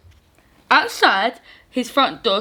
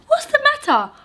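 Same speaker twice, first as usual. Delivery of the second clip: George voice; faster than usual. Acted. George asks the dog.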